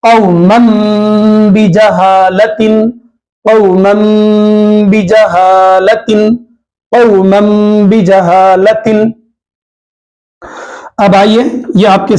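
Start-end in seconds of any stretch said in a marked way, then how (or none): 0.0-8.9 "قوما بجہالت" said over and over, "بجہالت" drawn out very slowly; slow repeated drill with meem. Shown as a demonstration. Teacher drills students on the tanween rule, slowly. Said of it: "یہ نون کی آواز کو میم سے بدلنا ہے".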